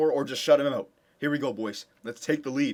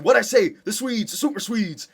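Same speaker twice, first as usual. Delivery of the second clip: excited gloating; 8 semitones higher, 6 dB louder than usual. Real, not acted. Goals by his Swedish stars.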